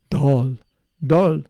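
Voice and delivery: "dal" with a heavy Indian d, leaned on; Indian-style dal. Retold or imitated. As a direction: correct them with an Indian "dal". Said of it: "I've had this with Indians".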